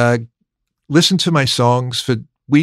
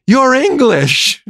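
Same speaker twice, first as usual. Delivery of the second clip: high, drawn-out retold astonishment; amazed exclamation; 12 semitones higher, 4 dB louder, much slower than usual. Meeting the first English person of his life, amazed.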